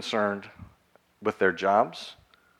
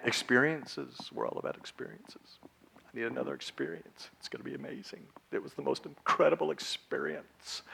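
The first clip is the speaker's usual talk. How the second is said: much softer, breathy reverence for experiences; mock hushed awe; 2 semitones higher, 10 dB quieter than usual. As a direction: gush under your breath like a starry-eyed traveller. Mocking the experience-chasing generation.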